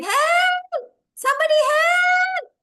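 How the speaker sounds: very high, loud, long-held cry; shouting for help. Acted. Lost boy shouts once again for rescue, high loud yell. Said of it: "Basava shouted once again".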